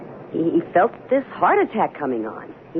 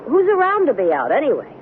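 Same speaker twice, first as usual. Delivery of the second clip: high, scornful brush-off; mock his fear as silly; wife waving off her husband's worry; dismissive; 10 semitones higher than usual.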